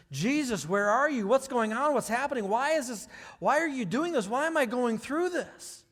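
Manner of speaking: loud, troubled plea for answers; anguished questioning. Acted. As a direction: cry out to God in doubt, question piling on question. Voicing doubters questioning Jesus.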